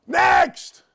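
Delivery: loud high shout of next; calling out. Acted. Host moving on to the next topic, loud call. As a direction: bark it out like a game show host.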